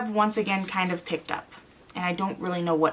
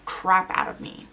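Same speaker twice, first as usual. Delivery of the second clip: normal talking voice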